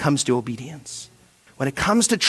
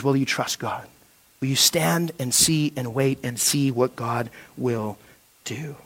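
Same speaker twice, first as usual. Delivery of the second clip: much softer and lower, intimate; soft appeal; 7 semitones lower, 12 dB quieter than usual. Acted. Preacher gently challenging the congregation, much softer. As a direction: lean in and ask it gently.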